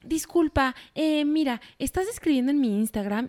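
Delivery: mucho más agudo, falsa cortesía amable; burlándose de la respuesta dulce que se espera; mock amable. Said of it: "una respuesta toda amable". (voz de niña buena exageradamente amable)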